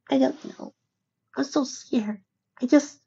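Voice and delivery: very high, small filly voice; scared little filly. A narrator's reading. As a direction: waver as a frightened little filly. Scared filly hesitates to go along.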